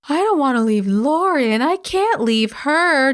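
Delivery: high, drawn-out mock wail; mock pleading. Host mimicking the mother refusing to leave, mock pleading. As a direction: whine it high, dragging the last words. Mocking Kendra's pleading to stay.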